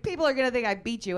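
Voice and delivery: fast, loud exasperated complaint; exasperated husband. Retold or imitated. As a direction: rush it out like a worried husband.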